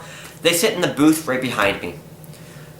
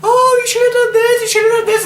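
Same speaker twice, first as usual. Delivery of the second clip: much higher, louder mock teacher voice; teacher impression; 4 dB louder than usual. Teacher lecturing her about what she should have done, high and mocking. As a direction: parody the preachy teacher. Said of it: "she's like".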